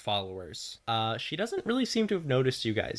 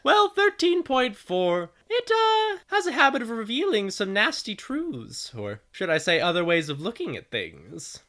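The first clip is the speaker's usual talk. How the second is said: radio voice; 11 semitones higher, 7 dB louder than usual. Acted. Radio explains the place to players, high radio voice. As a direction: put on a high, teasing radio voice. Game master plays the radio voice.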